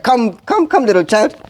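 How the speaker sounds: put-on high, sing-song beckoning to a child; mock coaxing call. Father beckons son to sacrifice, mock coaxing. He didn't do that.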